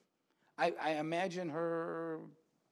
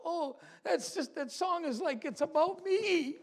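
higher, swooning imitation of a touched fan; mock sentimental listener; 8 semitones higher than usual. Preacher mocks a sappy country music fan, pitched up. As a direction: play the fan who thinks every sad song is about them. Listener moved by sad song.

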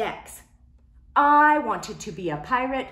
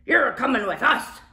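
louder pirate command; pirate order; 4 semitones higher, 6 dB louder than usual. Acted. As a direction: tell him firmly as captain. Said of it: "Braidbeard told me".

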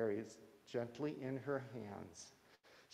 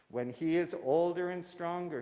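mother goddess voice; 6 semitones higher, 7 dB louder than usual. Acted. The mother speaks of the baby.

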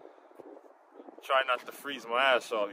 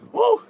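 shrill loud whoop; 13 dB louder than usual. Real, not acted. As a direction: let out a huge whoop. Reacting to the cold and pain.

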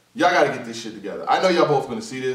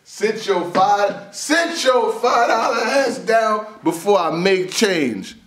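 high, loud and slow with a laugh, punching every word; mock Danny comeback; 9 semitones higher, 7 dB louder, much slower than usual. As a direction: deliver the New Jack City line, slowly, half laughing. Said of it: "Danny said".

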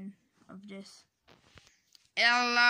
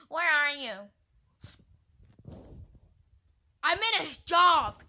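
slow, louder calling; 4 semitones higher, 9 dB louder, much slower than usual. Pretend. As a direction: call across the map. Calling out to a friend.

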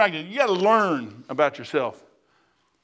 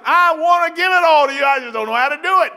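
high, loud, straining plea; pleading up to heaven, voice cracking high; pleading cry; 12 semitones higher, 11 dB louder than usual. Retold or imitated. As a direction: cry out to God, desperate and eager. Begging God, voice rises high.

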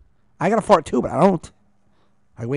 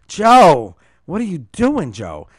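exasperated call; 4 semitones higher, 8 dB louder, slower than usual. Retold or imitated. Waking up, calling out.

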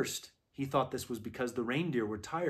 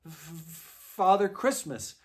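slow, higher, stuttered first sound; frightened stammer; 5 semitones higher, 6 dB louder, slower than usual. A narrator's reading. Fox confesses to the witch, stammering nervously. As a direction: stick on the F, then blurt it louder. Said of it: "stammered the fox".

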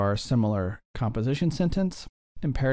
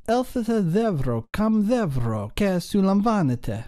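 calling out; 8 semitones higher than usual. Acted. Teacher calls commands to slaves.

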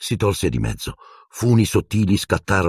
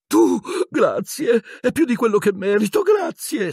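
high, squeaky gushing thanks; grovelling wretch. A narrator's reading. Pettigrew thanking Harry for his life, grovelling squeak.

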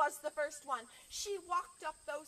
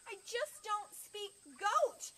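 high, regretful, emphatic apology; apologetic librarian; 7 semitones higher than usual. Acted. Librarian tells the goat she can't help, apologetic. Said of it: "looked at the poor goat".

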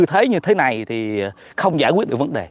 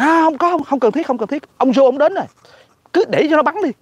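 mock dismissal; 6 semitones higher than usual. Voices UN dismissing the request.

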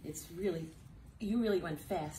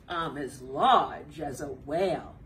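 low, slow, loud booming voice; deep big whale voice; 6 semitones lower, 9 dB louder, slower than usual. Acted. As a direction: boom it out like a huge whale.

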